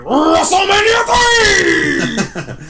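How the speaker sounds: high, drawn-out wrestling announcer roar; hammed-up shouted promoter impression, very slow; Vince McMahon. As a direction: stretch it into a huge event announcement. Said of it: "Vince McMahon saying".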